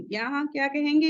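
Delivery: usual speaking voice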